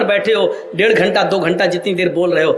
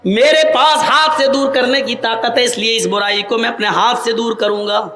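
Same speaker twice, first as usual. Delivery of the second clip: ordinary talk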